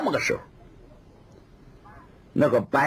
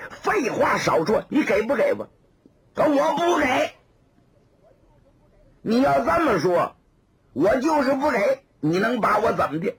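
heated quarrel; 8 semitones higher than usual. Acted. Quarrel over umbrella.

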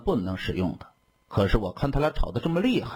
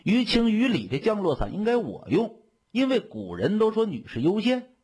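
lightly voiced smug young lady, higher pitch; 7 semitones higher than usual. A narrator's reading. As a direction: claim priority with higher, pleased self-importance. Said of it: "很是得意". (略带得意，音调偏高)

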